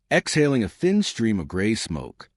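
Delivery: usual speaking voice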